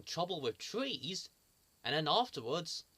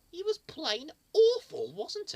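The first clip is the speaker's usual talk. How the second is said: loud, much higher hammed disgust; mock disgust; 10 semitones higher, 8 dB louder, slower than usual. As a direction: overplay how awful he was.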